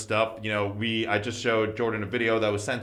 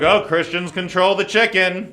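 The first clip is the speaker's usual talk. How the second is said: mocking voice; 6 semitones higher, 4 dB louder than usual. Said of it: "no one's like".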